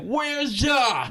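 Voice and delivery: playful hollering for a friend; mock calling out. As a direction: shout for Ja playfully. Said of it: "I'm about to call Ja like".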